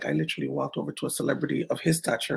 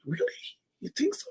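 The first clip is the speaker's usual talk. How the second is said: surprised; 6 dB quieter, faster than usual. Retold or imitated. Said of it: "So I'm like".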